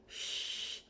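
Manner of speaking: soft, voiceless "shh"; library shush. Shown as a demonstration. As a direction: hush someone as in a library. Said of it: "when you go to the library and you say".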